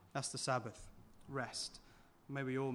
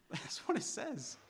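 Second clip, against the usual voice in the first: laughing repeat; 6 semitones higher than usual. Real, not acted. Preacher laughing at his own point to the congregation. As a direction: repeat it again through a laugh.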